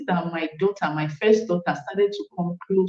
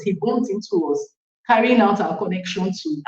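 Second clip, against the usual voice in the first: ordinary delivery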